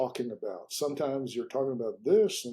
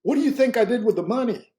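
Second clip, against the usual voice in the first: high, loud teasing question; spring the question on him, louder and higher; startling question; 9 semitones higher, 7 dB louder, faster than usual. Retold or imitated. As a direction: Nelson testing his listener, loud and high-pitched. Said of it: "he said something that just startled me".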